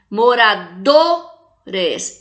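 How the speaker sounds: "Moradores" very slow, long strong "do", break before "res"; stressed penultimate syllable. Shown as a demonstration. Teacher demonstrating paroxytones to class, "do" stressed in "Moradores". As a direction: hold and push "do" in "Moradores". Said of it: "é o do".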